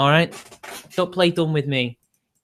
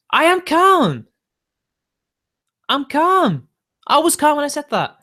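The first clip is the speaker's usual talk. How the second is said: much higher, louder agitated protest; insisting he is calm; 11 semitones higher, 4 dB louder than usual. Real, not acted. Streamer snapping back at a chat troll, agitated high protest. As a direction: loudly insist you're calm while clearly riled. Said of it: "I need to calm down".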